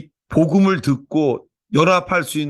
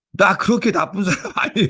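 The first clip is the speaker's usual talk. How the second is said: put-on higher childish protest breaking into a laugh; childlike; 7 semitones higher than usual. Pastor retelling the child's innocent reply, laughing childishly. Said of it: "어린아이답게".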